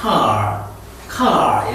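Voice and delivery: car with a curled, rolled-back r; 혀를 구부린 미국식 car; American r in car. Shown as a demonstration. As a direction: bend the tongue for the r in car. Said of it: "bend your tongue like this".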